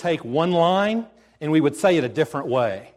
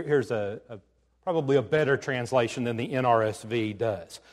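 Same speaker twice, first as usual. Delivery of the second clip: ordinary delivery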